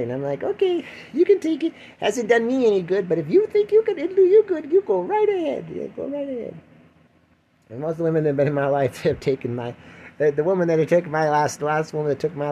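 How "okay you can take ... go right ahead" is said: mock cheery permission. Retold reply to partner.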